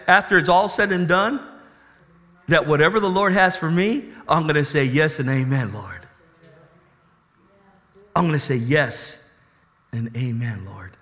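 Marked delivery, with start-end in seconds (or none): 4.8-11.0 reverent yes. Acted. Believer answering the Lord, lower and fading to a hush. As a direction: sink into humble surrender.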